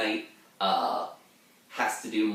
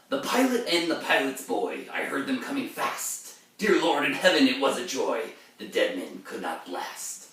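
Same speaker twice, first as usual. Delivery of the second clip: verse recital with a sharp rise on Oh Christ; heightened reading of ballad verse; dramatic poem reading; 5 semitones higher than usual. Acted. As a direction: read it as the haunted mariner.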